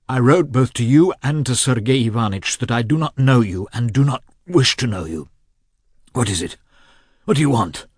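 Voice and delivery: hostile. A narrator's reading. Nikolai rejecting his brother's visit, harsh and hostile. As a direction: push him away with cruel suspicion.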